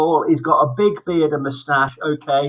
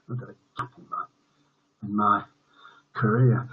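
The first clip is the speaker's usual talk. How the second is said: whispered aside; 4 semitones lower, 6 dB quieter than usual. Real, not acted. Aside about the career gap.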